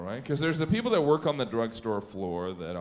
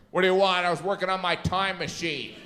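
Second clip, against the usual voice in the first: loud, higher, put-upon demand; inconvenienced pharmacist; 5 semitones higher, 3 dB louder than usual. Acted. Pharmacist interrupted at his work by a customer, loudly annoyed. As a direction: act interrupted and put out, voice raised. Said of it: "seem like you're inconveniencing the pharmacist".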